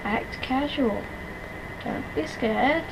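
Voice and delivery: quiet, slow, hushed self-talk. Real, not acted. Hiding from monster in game.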